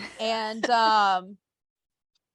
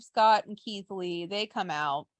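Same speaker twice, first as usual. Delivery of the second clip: ordinary talk, nothing marked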